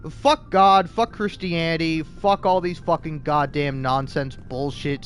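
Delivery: ordinary talk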